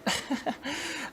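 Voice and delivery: short soft chuckle to yourself; soft amused chuckle; quiet laugh. Real, not acted. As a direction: laugh under your breath, amused. Laughs at own tape talk.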